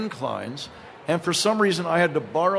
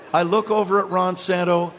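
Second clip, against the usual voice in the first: ordinary talk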